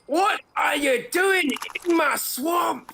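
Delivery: put-on hammered out high and slow in a Scottish accent, shouted like an ogre. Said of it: "That's a good that's a good accent".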